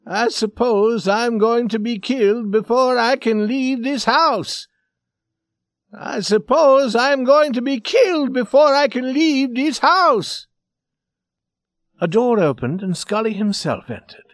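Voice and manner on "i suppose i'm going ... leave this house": high, tense dread, rising on the repeat; controlled fright. Acted. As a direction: insist on your doom, voice pitched way up. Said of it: "an apparent struggle to control his fright".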